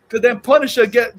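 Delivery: usual speaking voice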